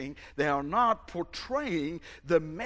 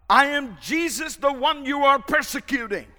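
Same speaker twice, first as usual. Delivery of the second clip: loud, high proclaiming voice; voice of Jesus; 8 semitones higher, 9 dB louder than usual. Acted. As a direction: announce yourself with authority. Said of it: "the voice said and replied".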